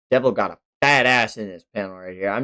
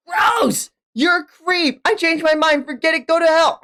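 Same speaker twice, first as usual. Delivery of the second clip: put-on disgusted girl. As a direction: shriek it at the creep. Voicing girl's disgusted rejection.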